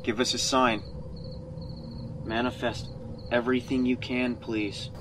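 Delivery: hushed plea to a spirit; soft calling. Real, not acted. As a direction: coax the ghost gently.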